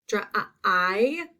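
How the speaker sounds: the dri of driveway broken into dr-uh and I; sounded-out long I. Shown as a demonstration. Teacher shows the long I sound, taken apart. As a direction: isolate the I inside dri and hold it. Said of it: "I hear that long I sound".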